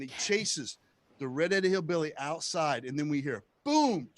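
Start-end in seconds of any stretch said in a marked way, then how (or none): none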